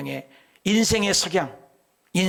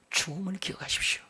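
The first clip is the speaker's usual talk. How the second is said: hushed reminder; 4 semitones higher, 7 dB quieter than usual. Acted. Repeated solemn reminder to king.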